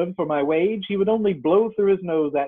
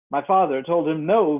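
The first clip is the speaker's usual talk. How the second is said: ordinary delivery, nothing marked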